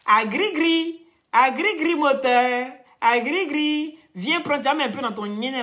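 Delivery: high, slow, drawn-out sales cry; hawker's call. Acted. Selling her potion to the viewers, high drawn-out hawker's cry. Hawking her product, sing-song.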